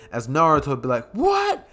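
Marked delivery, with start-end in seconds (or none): 1.1-1.7 a startled, strangled high rasp; weird raspy voice. Acted. Naruto reacting to Deku, weird raspy what. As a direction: jump way up into a raspy squawk. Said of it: "he'd do that weird raspy voice".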